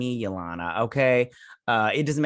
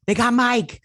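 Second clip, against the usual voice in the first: much higher and louder, bursting out; excited outburst; 12 semitones higher, 6 dB louder than usual. Real, not acted. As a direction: blurt it out high and loud the second time. Repeats news, then exclaims.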